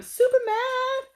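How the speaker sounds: high squeaky mad exclamation; mock shrieked. Exaggerated exclamation.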